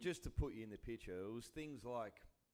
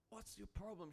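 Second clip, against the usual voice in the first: mock confrontation; 9 semitones higher, 6 dB quieter than usual. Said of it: "I'm like".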